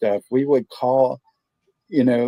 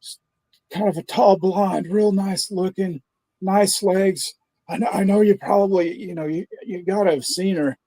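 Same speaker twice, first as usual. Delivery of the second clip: higher, pleading husband voice; prank caller; 8 semitones higher than usual. Retold or imitated. Prank caller asking the pizza clerk for his wife, pitched-up worried husband. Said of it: "And he goes".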